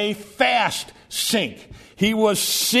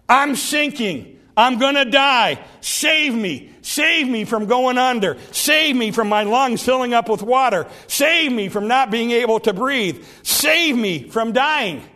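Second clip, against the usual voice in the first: acted plea of a drowning man; raised, insistent begging to be rescued; desperate cry; 2 semitones higher than usual. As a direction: plead urgently for your life. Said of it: "a short cry, to the point".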